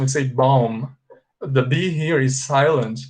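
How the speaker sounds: bomb said with no B at the end; bomb, final B unspoken; silent B. Shown as a demonstration. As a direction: bomb rhymes with mom, B silent. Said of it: "Pay attention to the pronunciation".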